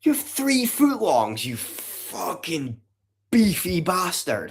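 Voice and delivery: high, slow, drawn-out teasing voice; mock insult. Teasing a chat member about eating three footlongs, high slow mock voice.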